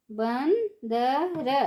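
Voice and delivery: बंदर stretched slowly, syllable by syllable; slow syllable drill. Shown as a demonstration. Teacher drawing out बंदर slowly for children. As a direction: draw out बंदर for the class. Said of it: "ऊंची आवाज़ में कैसे पढ़ेंगे".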